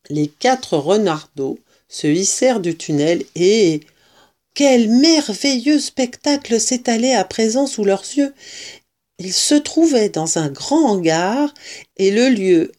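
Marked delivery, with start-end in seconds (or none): none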